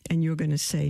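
ordinary delivery

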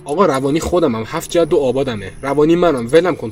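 loud desperate giving in; panicked confession. Acted. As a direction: shout it out to stop the knife.

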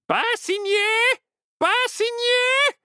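very high, loud parrot squawk; shrieking parrot voice, second cry drawn out. A narrator's reading. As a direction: squawk a frantic warning like a parrot. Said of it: "Le perroquet cria".

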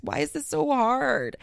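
slow, pleading inner question; exasperated inner voice. Retold or imitated. Asking herself in her head why cleaning is hard, slow and plaintive. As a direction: let it sag with frustration. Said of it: "in my head, that's what I was saying".

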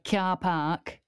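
broad put-on Leeds vowels on car park; demonstrating a Leeds accent. Shown as a demonstration. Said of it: "Leeds is probably my favourite accent".

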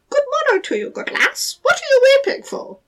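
high squeaky little goblin voice; gravelly sly hobgoblin. Acted. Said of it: "said the hobgoblin".

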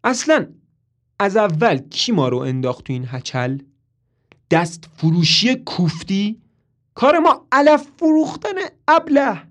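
loud, high reproach; scolding. A narrator's reading. Marilyn blaming Toby, loud angry reproach. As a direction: berate him sharply.

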